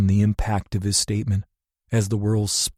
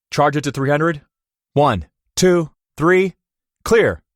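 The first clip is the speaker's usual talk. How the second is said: loud, higher counted command; doctor shocking the patient, loud; doctor's count; 6 semitones higher, 6 dB louder than usual. A narrator's reading. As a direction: count down and call clear. Doctor defibrillating.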